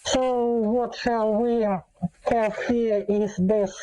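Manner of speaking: ordinary delivery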